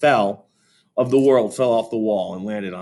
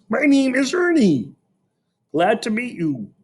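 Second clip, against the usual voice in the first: much higher cheerful puppet voice; Ernie voice; 14 semitones higher than usual. A narrator's reading. Fudge introducing himself as Ernie, high puppet voice. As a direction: play the friendly Muppet. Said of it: "in his best Sesame Street voice".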